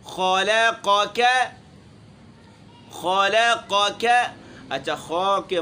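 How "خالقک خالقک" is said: خالقک slowly, with heavy kha and qaf; slow careful خالقک; heavy kha and qaf, thin lam and kaf. Shown as a demonstration. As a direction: pronounce each letter of خالقک with its right weight. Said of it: "خا موٹا، لام باریک، پھر قاف موٹا، کاف باریک".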